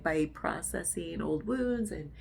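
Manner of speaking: usual speaking voice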